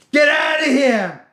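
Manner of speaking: high, loud drawn-out yell; angry shout. Retold or imitated. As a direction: imitate a man yelling someone off his property. Customer repeats angry yell.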